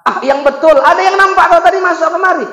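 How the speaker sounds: high, loud disbelieving accusation; the king won't buy it; accusing king. Acted. King accuses Ibrahim of sneaking in, loud accusation.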